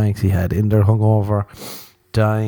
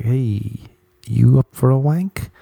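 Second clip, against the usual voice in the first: lightly voiced undercover cop, rising at the end; come-on from cop; 4 semitones higher, slower than usual. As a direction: proposition someone as a mock plainclothes cop. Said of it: "The cop cracked onto him, was like".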